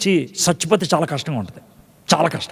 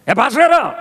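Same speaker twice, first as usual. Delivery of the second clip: "ఏ pastor గారు" called higher and sharp with irritation; irritated call to the pastor, higher and sharp; annoyed call; 5 semitones higher, 3 dB louder than usual. Shown as a demonstration. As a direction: snap the call at him. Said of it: "ఇదో పిలుపు".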